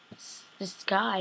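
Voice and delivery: slow, soft reveal; slow hushed. Retold or imitated. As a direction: drop the voice for an ominous reveal. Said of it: "you're just like".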